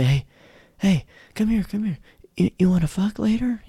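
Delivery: high, sleazy bar come-on; mock pickup line. Guy at the bar hitting on a stranger, high mock sleazy come-on. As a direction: ham up a crude pickup line.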